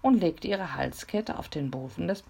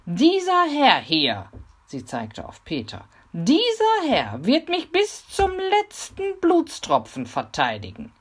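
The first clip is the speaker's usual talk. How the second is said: shouting proudly; 8 semitones higher, 9 dB louder than usual. A narrator's reading. Mimi presents Peter to the robbers, shouting proudly. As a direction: announce your champion with pomp. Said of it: "schrie sie".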